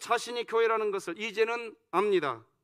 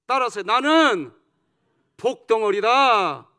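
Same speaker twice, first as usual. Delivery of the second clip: loud chant line, last word stretched; call to repeat; 8 dB louder than usual. Real, not acted. Preacher leading the congregation in declaring themselves blessed, loud drawn-out call. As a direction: call it out slowly for the crowd to echo.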